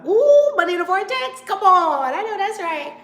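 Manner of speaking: loud, high whooping hail; excited shout-out. Real, not acted. Host hailing a viewer who joined, excited shout. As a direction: holler with delighted approval.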